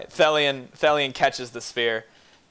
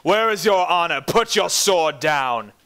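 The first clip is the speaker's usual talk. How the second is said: paladin voice; 4 semitones higher, 6 dB louder than usual. Acted. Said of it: "paladin voice".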